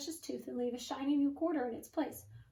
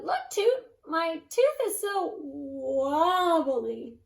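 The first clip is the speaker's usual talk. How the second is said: high, excited little voice, slowing on the wobble; excited child; 3 semitones higher, 9 dB louder, much slower than usual. Acted. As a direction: show off the wobbly tooth. Excited child showing loose tooth.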